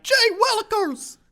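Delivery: put-on high squeaky country boy; excited yokel kid voice. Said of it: "He's just like".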